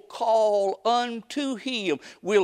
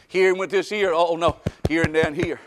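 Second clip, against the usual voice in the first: ordinary talk, nothing marked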